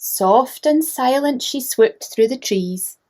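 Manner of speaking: ordinary delivery, nothing marked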